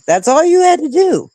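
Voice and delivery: ordinary delivery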